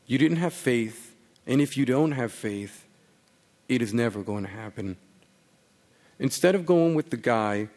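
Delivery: measured reading with pauses between phrases; literary story reading. Acted. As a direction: read the letter out quietly, phrase by phrase. Reads a story letter aloud.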